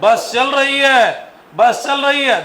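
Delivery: loud, high, drawn-out bus call; teacher acting out a bus in motion; lively bus call. Shown as a demonstration. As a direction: sell the moving bus to the class. Teacher mimicking moving bus.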